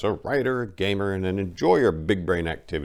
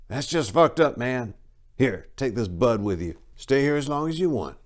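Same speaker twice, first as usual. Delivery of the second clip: higher, voiced farmer with a casual sympathy; farmer's voice; 6 semitones higher than usual. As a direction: say it like the easygoing landowner. Farmer reacting to answer.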